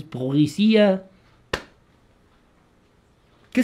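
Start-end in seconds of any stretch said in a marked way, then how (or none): 0.0-1.0 "policía" drawn out with a mock French accent. Shown as a demonstration. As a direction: put a fake French pronunciation on "policía". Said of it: "cómo se pronunciaría en francés". (acento francés fingido)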